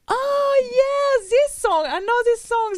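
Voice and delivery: high, loud recognition; excited shout. Retold or imitated. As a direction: shout it out with delight. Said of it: "I said".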